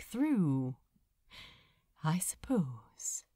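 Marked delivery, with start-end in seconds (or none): 2.0-3.2 low whispered murmur. Acted. Angel answering his lover under thunder, murmured. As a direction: barely breathe it, resigned. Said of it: "murmured Aziraphale".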